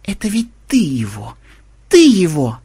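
shocked, accusing exclamation; stunned realisation. A narrator's reading. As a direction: blurt it out, thunderstruck. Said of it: "потрясённо пробормотала Мелисса". (потрясённо, с изумлением)

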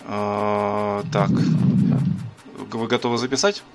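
ordinary delivery

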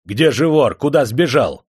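loud, high angry questioning; furious interrogation; enraged. A narrator's reading. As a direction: fire questions at him in fury. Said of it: "Не на шутку вдруг рассвирепел".

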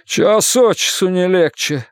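slow exasperated wail. A narrator's reading. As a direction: groan it out, fed up. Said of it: "взвыл Джерри".

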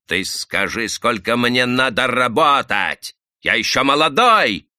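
нарастает от ровного к громкому злому крику; сорвись на крик со злости; злобный крик. Acted. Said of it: "закричал со злобы". (парень злобно кричит на рабочего)

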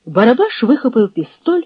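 plain reading voice

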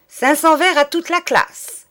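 loud, high furious shout; teacher furiously punishing the whole class. Acted. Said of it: "d'une voix furieuse".

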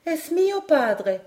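voix d'enfant douce, haute et lente. A narrator's reading. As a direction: dire tendrement, lentement, dans l'aigu. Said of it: "dit d'une voix douce". (doux, aigu et lent)

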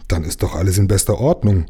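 plain reading voice

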